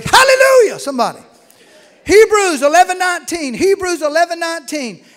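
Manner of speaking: loud, high shout of hallelujah; full-force preacher's cry; preaching shout. Real, not acted. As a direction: lift the church with a full-voiced shout.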